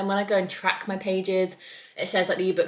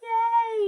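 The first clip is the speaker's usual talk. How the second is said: excited gasp; 4 dB louder than usual. Real, not acted. Setup done.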